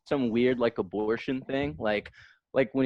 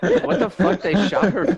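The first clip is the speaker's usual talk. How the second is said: loud laughing what the fuck; laughing disbelief; 7 semitones higher, 6 dB louder than usual. Real, not acted. Friend laughing at news [name] was shot. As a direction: blurt it out laughing.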